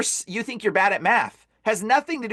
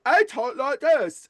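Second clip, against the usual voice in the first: slow, thick, higher mimicry; imitated impaired speech; 5 semitones higher, slower than usual. Storyteller shows girl's usual speech, slow slurred imitation. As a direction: imitate laboured, slurred talking. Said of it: "a girl that was like".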